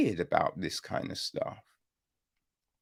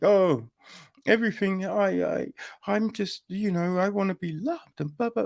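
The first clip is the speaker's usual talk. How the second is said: higher, stammering mock self-pity; whiny mock victim; 6 semitones higher than usual. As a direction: play a pitiful partner whining. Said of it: "the put upon person is talking".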